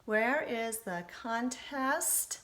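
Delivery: slow, rising at the end like a question; question rise. Shown as a demonstration. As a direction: let the voice climb at the end to ask. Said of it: "raised my voice at the end".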